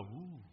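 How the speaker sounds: very soft, low "ooh"; hushed mock-awe "ooh"; awed "ooh". Acting out the congregation's expected response to the preacher, hushed awe. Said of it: "you were supposed to go".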